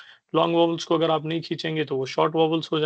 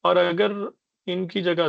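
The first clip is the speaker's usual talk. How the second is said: ordinary delivery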